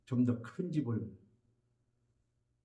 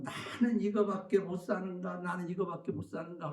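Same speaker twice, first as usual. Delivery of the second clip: mock grumbling; 4 semitones higher, 4 dB quieter than usual. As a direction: imitate a higher-pitched, self-pitying complaint. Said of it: "원망 불평하고". (원망하고 불평하는 흉내)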